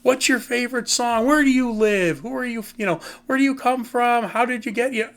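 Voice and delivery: mock-cheerful rock star greeting a fan; friendly smiling reply. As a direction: beam at the fan and pepper him with questions. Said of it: "It would be a smile".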